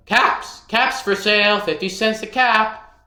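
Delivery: high, loud peddler's cry; calling out wares. A narrator's reading. Peddler calling to passersby, loud and high. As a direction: sing it out to the street. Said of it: "walked back to town calling".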